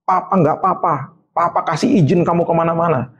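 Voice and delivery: high, emphatic retold telling-off; imitated angry father. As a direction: build a mock paternal scolding to a stern warning. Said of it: "lihat dia marah kayak gitu".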